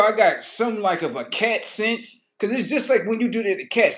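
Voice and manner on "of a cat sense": ordinary talk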